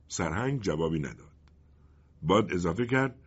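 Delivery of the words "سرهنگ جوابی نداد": usual speaking voice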